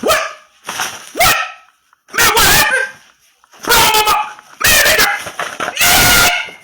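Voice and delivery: very high, loud screamed outburst; outraged reaction. Acted. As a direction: scream it in mock shock and outrage. Acting out reaction to hearing friend's story.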